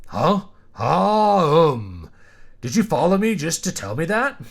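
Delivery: startled, loud and high, then trailing off; a flustered man's high yelp; flustered surprise. Acted. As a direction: caught off guard and fumbling. Startled man questions the woman.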